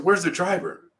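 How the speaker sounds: high-pitched insistent question, retold; frantic. Frantic man repeats his question to the victim, retold as insistent. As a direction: imitate a frantic man asking urgently. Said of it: "running around frantically".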